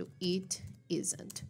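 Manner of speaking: "it isn't" said slowly and softly, T as a tapped R; careful demo of the flap; slow soft flapped T. Shown as a demonstration. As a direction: breathe it out gently with a flap. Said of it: "Fica mais ou menos assim".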